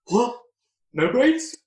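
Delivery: high, loud disbelief; real shocked outburst at the name; incredulous. Real, not acted. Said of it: "GM reacts to zombie name".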